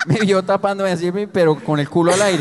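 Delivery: normal talking voice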